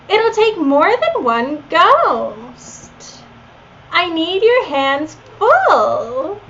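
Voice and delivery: put-on high, slow Valley Girl uptalk. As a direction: stretch every line like a Valley Girl. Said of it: "I did a Valley Girl voice for Leon".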